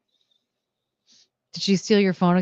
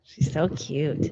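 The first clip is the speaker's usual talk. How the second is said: doting coo; 5 semitones lower, 6 dB quieter, slower than usual. Real, not acted. Doting on dog, slow soft.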